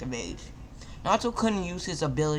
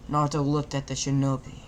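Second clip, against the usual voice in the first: ordinary delivery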